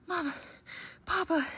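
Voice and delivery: high, urgent cub calling out; breathless gasp. A narrator's reading. Cubs burst in calling their parents, breathless and excited. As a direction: gasp it out loud, short bursts. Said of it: "they gasped".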